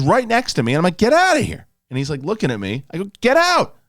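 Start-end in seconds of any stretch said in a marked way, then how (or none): 1.0-1.7 high, loud get-out shout; shooing yell. Retold or imitated. Shooing the squirrel out of the office, high loud shout. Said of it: "I start screaming at a squirrel". 3.2-3.8 high, loud get-out shout; shooing yell. Retold or imitated. Shooing the squirrel out of the office, high loud shout. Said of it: "I start screaming at a squirrel".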